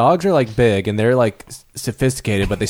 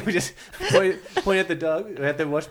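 normal talking voice

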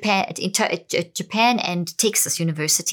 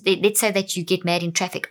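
ordinary talk

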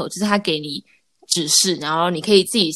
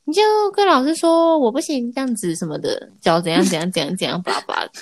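imitating mom; 6 semitones higher than usual. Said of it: "然后妈妈说".

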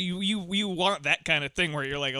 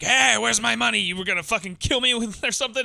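raised, louder mock villain outburst; mock angry demand; 5 semitones higher, 3 dB louder than usual. Villain demanding his money, mock angry and pitched up. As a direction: imitate an indignant villain demanding cash. Acts out Cassell confronting the pair.

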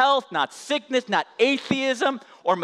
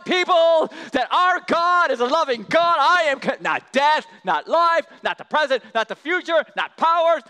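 louder, higher fervent preaching; excited testifying; 8 semitones higher, 6 dB louder than usual. Retold or imitated. Preacher voicing Paul rising from his chair to testify. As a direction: stand up and testify with fire. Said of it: "he's like".

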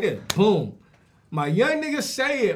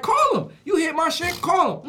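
indignant outburst; 8 semitones higher than usual. Retold or imitated. Rob angrily challenging the driver.

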